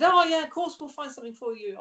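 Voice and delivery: high, loud opening that eases down; breezy quoted reply. Retold or imitated. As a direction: imitate an eager, obliging yes. Acting out Clive's ready, reassuring reply.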